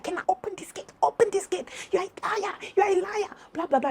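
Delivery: very high mock yelling, held back in volume; imitated demanding shouts. Friend banging at the gate, high imitated yelling. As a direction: mimic her high-pitched calls from outside. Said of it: "she's banging at my gate and she's like".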